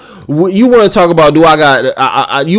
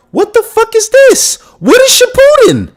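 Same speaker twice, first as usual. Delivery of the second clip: much higher, stunned squeal of confusion; shocked disbelief. Retold or imitated. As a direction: act out yelping in total shock at the screen. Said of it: "I'm like".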